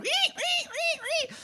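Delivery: high squeaky toy squeal; squeaky toy noise. Retold or imitated. The DM voices the orc's squeak toy as a high squeal. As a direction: squeak like a chewed dog toy.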